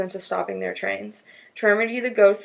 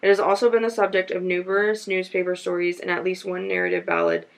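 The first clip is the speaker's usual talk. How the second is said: ordinary talk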